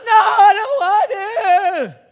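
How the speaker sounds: put-on high, loud, slow whiny child's wail; imitating toddler tantrum. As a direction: mimic a kid refusing bed.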